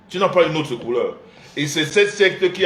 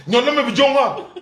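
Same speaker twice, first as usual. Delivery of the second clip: higher, punchier repeat of dad's line; lightly voiced father, higher; father's retort again; 6 semitones higher than usual. Repeating father's put-down for the audience, higher. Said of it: "Pour la traduction".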